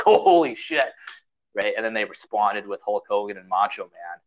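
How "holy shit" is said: a high, laughing burst of amazement; amazed crowd. Retold or imitated. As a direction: gasp it out like a stunned fan. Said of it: "everybody was like".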